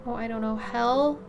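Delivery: ordinary delivery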